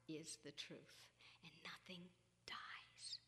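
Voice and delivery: very soft, low near-whisper; hushed and solemn. Real, not acted. A mother accepting that nothing dies, hushed and solemn. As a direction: let it sink almost to a whisper. Solemn reassurance about death.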